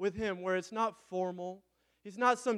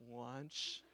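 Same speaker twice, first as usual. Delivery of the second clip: put-on soft, lower toddler echo of one word; imitating toddler; 5 semitones lower, 12 dB quieter than usual. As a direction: mimic a tiny kid parroting back. Dad imitates toddler son repeating.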